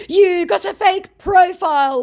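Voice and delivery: very high, loud jeer; mock scammer accusation. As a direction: imitate an overwrought scammer.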